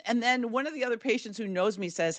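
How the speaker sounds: normal talking voice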